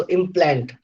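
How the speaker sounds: implant with weight on plant; second-syllable stress. Shown as a demonstration. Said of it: "the second syllable plant is stressed".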